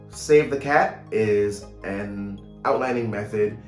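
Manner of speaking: usual speaking voice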